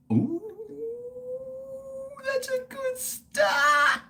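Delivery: very high, slow squeal. Real, not acted. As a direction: squeak it out with delight. First sip of beer.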